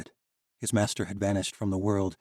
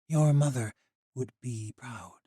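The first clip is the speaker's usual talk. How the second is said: tender comfort; 5 semitones higher, slower than usual. Acted. Ancient girl comforting.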